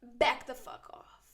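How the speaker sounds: hissed threat under breath; menacing whisper. Retold or imitated. Warning Ashley on the phone, in a menacing whisper.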